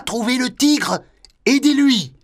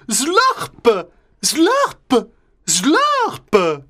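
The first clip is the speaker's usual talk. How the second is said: slurping noise; much slower than usual. Acted. Slurping juice.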